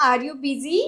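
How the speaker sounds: "Are you busy" with a rise on busy; question tone. Shown as a demonstration. Teacher repeating the English question for learners, question tone. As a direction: ask it, voice going up on "busy". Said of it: "क्वेश्चनचा tone येणं गरजेचं आहे".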